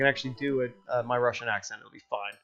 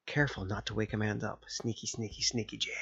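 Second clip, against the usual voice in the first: low, soft creeping-past-a-sleeper murmur; sneaking hush; 5 semitones lower, 11 dB quieter than usual. Acted. Dad creeping in without waking Amanda, hushed and sneaky. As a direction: keep it down as you tiptoe past.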